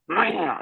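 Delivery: high-pitched meow to lure a raccoon; coaxing a raccoon with a cat call; mock meow. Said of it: "And I was like".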